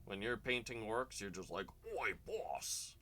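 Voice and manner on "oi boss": high, far Cockney orc yelling "Oi, boss!". Acted. Painter on stream doing a Cockney orc shouting "Oi, boss!". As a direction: play a Cockney orc calling out to his boss. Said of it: "Cockney accent, just like the orcs".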